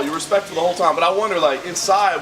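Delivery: ordinary talk, nothing marked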